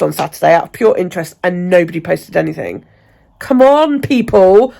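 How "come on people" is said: louder, higher come on to the viewers; rally them loudly; calling out. Real, not acted. Vlogger urging group members to post, loud call.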